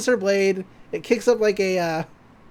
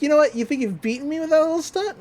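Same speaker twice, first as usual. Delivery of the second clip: high-pitched mock indignation; quick, rising retold challenge; defiant comeback; 7 semitones higher, faster than usual. Beaten villain answering back, defiant and indignant.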